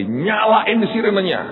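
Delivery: high, drawn-out command; retold emergency instruction, pitched high; urgent order. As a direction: call out an order to the driver. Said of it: "Saya bilang sama Hengki".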